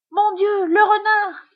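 high, loud cry of fright; panicked high-pitched shout; alarmed cry. A narrator's reading. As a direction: cry out at the sight of danger. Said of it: "crie Josette".

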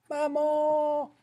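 put-on very high, drawn-out little-girl wail; child's cry. Little girl as the wolf nears, high-pitched cry. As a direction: cry out high for mum, holding the vowel.

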